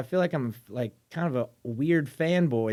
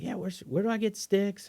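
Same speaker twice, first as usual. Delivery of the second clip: lightly voiced student, pitched higher; imitated student; 9 semitones higher, 3 dB quieter than usual. Host imitating students asking at music school, lightly voiced student. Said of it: "anytime students are like".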